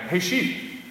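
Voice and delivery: a high, rising call to the sheep. Retold or imitated. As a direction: sing out a friendly hey with a lift at the end. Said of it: "and I called out".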